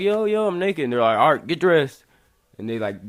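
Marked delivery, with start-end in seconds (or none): 0.0-0.8 high, louder startled objection; retold alarmed protest. Protests to cops uncovering him. 1.2-2.0 loud, raised-pitch order; retold cop command. Cop orders him to dress.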